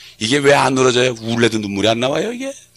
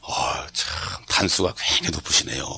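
whisper; 7 semitones lower, 8 dB quieter than usual. Retold or imitated. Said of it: "그 사람이 나보고".

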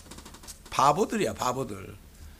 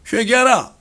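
preached call to repent; 4 semitones higher, 5 dB louder than usual. Acted. John the Baptist's call.

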